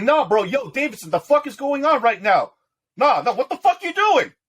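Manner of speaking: high, loud panicked shouting; panicked yell. Acted. Thug yelling at his crew as they grab him, high panic.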